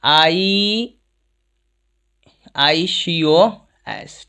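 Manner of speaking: आईसीओएस spelled letter by letter, very slow; spelled out slowly. Shown as a demonstration. Teacher spelling a prefix for students, slow. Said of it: "आईकोस बोलते हैं".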